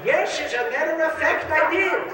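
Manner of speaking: put-on very high, pleased old man; high proud admission. Epstein proudly admitting the affair, high and pleased. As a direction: boast it, high and chuffed.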